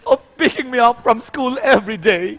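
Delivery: too dramatic crying. Shown as a demonstration. Teacher showing overdramatic adult crying for a child's line. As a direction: wail it like a soap opera. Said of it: "It's too dramatic for children".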